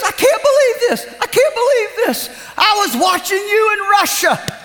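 high, laughing amazement; laughing disbelief. Retold or imitated. Preacher voicing a man amazed to see him on TV.

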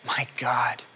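soft, whispered cry fading to breath; Jesus crying to God, whispered anguish. Acted. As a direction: let the plea die into a whisper. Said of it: "he cries out".

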